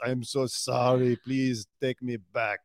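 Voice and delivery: put-on Schwarzenegger accent. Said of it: "now you sound like Arnold Schwarzenegger".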